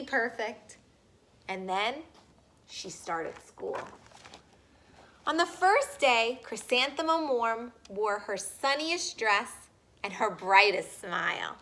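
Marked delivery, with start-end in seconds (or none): none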